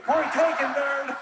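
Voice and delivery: high-pitched, punchy retort; exasperated comeback. Retold or imitated. Comedian answering the guy who corrected him, exasperated retort.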